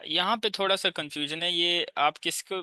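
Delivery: normal talking voice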